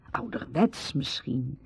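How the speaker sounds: ordinary talk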